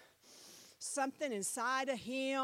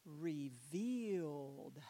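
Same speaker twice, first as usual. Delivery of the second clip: drawn-out low reveal; 9 semitones lower, 5 dB quieter, much slower than usual. Acted. Dramatic reveal of the word.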